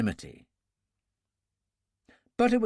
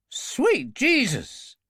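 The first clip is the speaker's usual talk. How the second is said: much higher, drawn-out shocked oath; shocked swear; 10 semitones higher, much slower than usual. Acted. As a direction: swear in shock as the brake fails. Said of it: "then swore".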